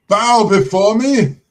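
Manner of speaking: booming pompous lord voice; dark lord. Pretend. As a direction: demand they bow, grandly. Mock self-important knight.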